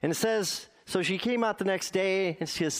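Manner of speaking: normal talking voice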